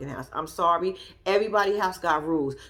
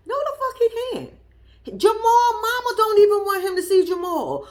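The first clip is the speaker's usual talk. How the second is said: outraged; 12 semitones higher, 6 dB louder than usual. Real, not acted. Outraged rebuttal of the family.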